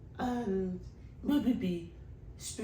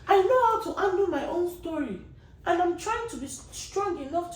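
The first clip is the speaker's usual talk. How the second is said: louder and higher, begging them to stop; raised pleading voice; 7 semitones higher, 6 dB louder than usual. Real, not acted. Pleading with followers who send trauma stories, loud and high. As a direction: plead hard, voice up and pushing.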